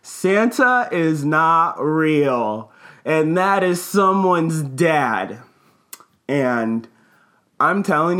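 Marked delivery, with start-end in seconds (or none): 0.0-5.4 slow, drawn-out mock yell of a little girl; half-scream it slowly, word by word. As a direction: imitate a child's loud announcement, stretching each word. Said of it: "scream at the top of her lungs".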